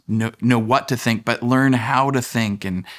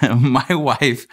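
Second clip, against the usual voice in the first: ordinary delivery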